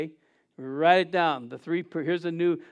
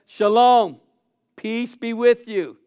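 announcing greeting; 4 semitones higher, 8 dB louder, slower than usual. Acted. Jesus greeting disciples.

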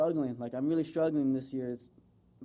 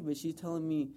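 ordinary talk, nothing marked

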